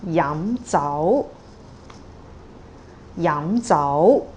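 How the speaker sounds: yam zao, both syllables rising; Cantonese rising tones. Shown as a demonstration. Teacher models yam zao, both rising. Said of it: "Another two plus two".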